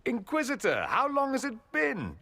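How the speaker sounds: put-on high, bright-eyed hello to an old friend; light up at seeing them again; delighted greeting. Greeting his old friend the Inquisitor, delighted and high.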